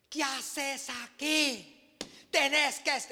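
mock angry rebuke, slowed on the first words; retold scolding. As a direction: play the angry boss, half-hearted. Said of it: "me pegó una gritada".

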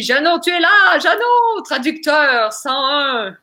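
big, high showman's call; grand entrance announcement. Acted. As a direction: announce him like a game-show host. Fait entrer l'invité en direct. (annonce d'entrée en grande pompe, aiguë et forte)

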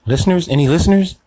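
high, loud call into emptiness. Acted. Host searching for listeners, loud high calling. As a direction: call out hoping someone answers.

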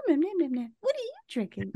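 high, mock-casual podcast chatter; mock chatty host. Hosts chatting calmly while the listener is sprayed, mocked high. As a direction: ham up the cheerful hosts munching away. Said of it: "And then we're just like".

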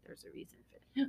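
fast, secretive whisper; hint at something eerie in a whisper; ominous whisper. Real, not acted.

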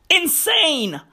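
ordinary talk, nothing marked

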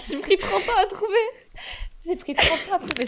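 high, loud excited exclaiming. Real, not acted. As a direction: cry it out in giddy disbelief. Excited self-mockery after finding.

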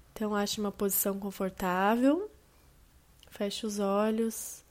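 slow, calm meditation voice with long pauses; guided meditation. Acted. Host guiding listeners through a body scan, slow and calm. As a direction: ease the listener down into relaxation, unhurried.

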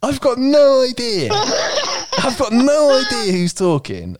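very high, drawn-out exasperated cry; comic despair, wailing high and slow. Real, not acted. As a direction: squeal it out in helpless frustration. Reader loses track of who speaks.

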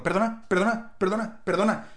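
nagging perdona over and over; insistent mock calling. Imitating his partner calling the waiter again and again. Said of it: "se puede estar un minuto seguido haciendo".

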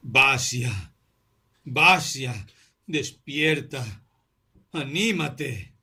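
rising, slowing plea near tears; Arkady begs his friend to wake, near tears; almost crying. A narrator's reading. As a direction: beg him to wake, voice about to break. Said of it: "exclamó casi llorando".

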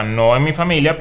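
usual speaking voice

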